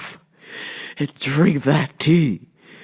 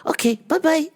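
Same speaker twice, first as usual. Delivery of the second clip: Miss Swan; 12 semitones higher than usual. Acted. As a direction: pitch it way up, imitating a comic accented character.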